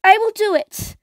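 brave, determined princess; mermaid voice. Acted. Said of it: "said the little mermaid".

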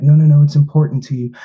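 mock her pleading it matters to him; insistent retold plea. Partner insisting he not skip the plan, loud and urgent.

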